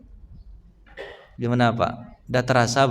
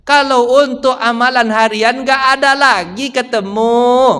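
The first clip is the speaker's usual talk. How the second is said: slow, emphatic, louder solemn quote; quoting Imam Nawawi; 7 semitones higher, 4 dB louder, slower than usual. Retold or imitated. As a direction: proclaim it like a grave old scholar. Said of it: "Kata Imam Nawawi".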